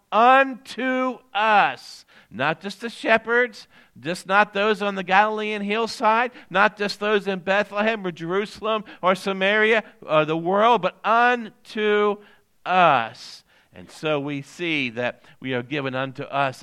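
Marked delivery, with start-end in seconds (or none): 0.1-2.0 "unto us" very slow, high and loud, each word set apart; emphatic UNTO US. Shown as a demonstration. Pastor telling the congregation to get this, stressing "unto us". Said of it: "get this now". 2.3-13.4 raised, emphatic preacher's delivery; preaching. Real, not acted. Pastor assuring the congregation of everlasting joy, preaching.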